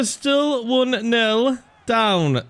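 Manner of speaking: ordinary delivery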